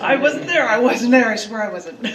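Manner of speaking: giggling I swear I wasn't; laughing protest. Real, not acted. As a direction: play the joking alibi while cracking up. Joking alibi amid group laughter.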